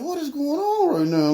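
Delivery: high, drawn-out mock disbelief; nervous bewilderment. Robbers silently asking each other what is happening, nervous bewilderment. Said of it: "they nervous 'cause they looking at each other".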